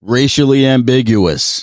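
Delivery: loud, slow, drawn-out announcement; emphatic interjection. Real, not acted. Co-host labels the kid mid-story, loud slow interjection. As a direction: proclaim it loudly, word by word.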